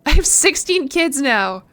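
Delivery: slow, stretched-out shock; astonished. Real, not acted. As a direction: react aghast to surprising news. Shocked by twins in game.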